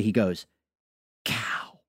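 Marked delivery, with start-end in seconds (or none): 1.2-1.8 unvoiced, soft and dead serious; very serious whisper. Toddler telling his dad what he heard, serious whisper. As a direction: say it like a solemn discovery. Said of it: "And just very seriously".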